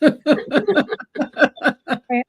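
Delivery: laughing through the words. Real, not acted. Laughing at the host's Chris Hemsworth question. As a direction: break into laughter as you start. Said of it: "Does he look like Chris Hemsworth?".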